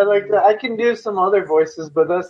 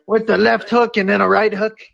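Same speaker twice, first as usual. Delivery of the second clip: terrible mock Scottish accent; Conor McGregor; 3 semitones higher than usual. Dad boasts of his punches, bad Scottish accent. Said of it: "your Scottish accent sounds terrible".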